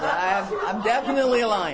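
laughing through the words. Real, not acted. Owning up to being a lion, laughing. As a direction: admits it with a real laugh.

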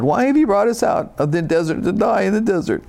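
high, nasal-free whiny complaint; mock whining voice; whining Israelites. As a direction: whine like ungrateful people. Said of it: "Can't you just hear him whining?".

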